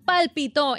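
palpitó stressed on TÓ, as past tense; stress on final O. Shown as a demonstration. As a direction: hit the last syllable of palpitó. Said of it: "En el pasado es palpitó".

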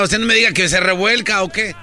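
ordinary talk, nothing marked

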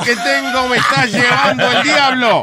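stoned newscaster. Pretend.

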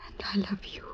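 breathless whisper of love. Acted. To her suitor, whispered love reply. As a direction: whisper it back to him. Lovers' tender confession.

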